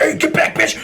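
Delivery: high-pitched mock yell; hammed-up high sergeant voice; drill instructor order. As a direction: imitate a sergeant ordering recruits back.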